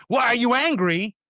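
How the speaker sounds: mock accusing question. Shown as a demonstration. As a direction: challenge them sharply. Harsh version of a question.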